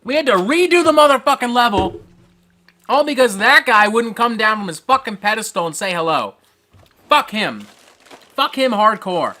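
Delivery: fuming gamer complaint; angry ranting. Real, not acted. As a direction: rant about the injustice, loud and high. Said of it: "I am fucking angry".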